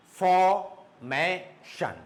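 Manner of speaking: Formation very slowly in three beats, for, may, shun; long pauses inside Formation; syllable by syllable. Shown as a demonstration. Teacher sounding out Formation for students, syllable by syllable. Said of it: "three syllables".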